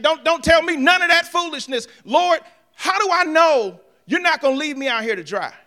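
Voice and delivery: a high, loud preacher's shout at the Lord; full-force shout. Acted. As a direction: cry out to God, exasperated and loud. Moses' outburst at God.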